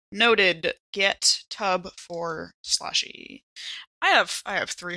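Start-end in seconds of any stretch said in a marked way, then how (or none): none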